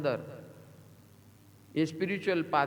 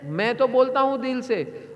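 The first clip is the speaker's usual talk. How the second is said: raised, fervent declaration; said loud from heart; 7 semitones higher, 4 dB louder than usual. Shown as a demonstration. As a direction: speak it out loud to inspire. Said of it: "मैं जोर से बोलता हूं". (दिल से ज़ोर से बोलना)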